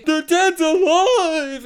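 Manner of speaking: high, loud, drawn-out cry of horror; hammed-up terrified shriek; panicked mock scream. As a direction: scream it like you've seen the dead move. Acting man shocked by living corpse.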